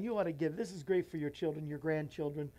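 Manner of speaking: ordinary talk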